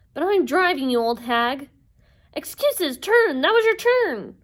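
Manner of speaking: high, loud, indignant bickering; squabbling. Acted. Sisters squabbling in the taxi, high indignant yelling. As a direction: argue shrilly over whose turn it is.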